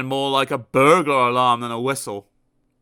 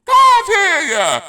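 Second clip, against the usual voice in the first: slow, much higher, very loud; roaring milkman; 12 dB louder, much slower than usual. Acted. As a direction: roar it out. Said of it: "roared the milkman".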